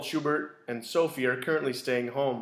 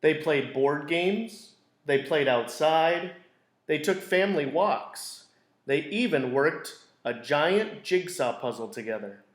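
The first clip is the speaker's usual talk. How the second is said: gentle storybook narration; teacher reading a picture book to a class; reading to children. A narrator's reading. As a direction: read it like circle time for kids. Reads picture book aloud.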